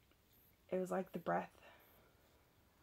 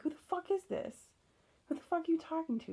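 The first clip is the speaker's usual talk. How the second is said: much higher, louder, fast accusing yell; furious demand; 11 semitones higher, 4 dB louder, faster than usual. Retold or imitated. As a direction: accuse him, nearly shrieking. Shouting at partner about the chat.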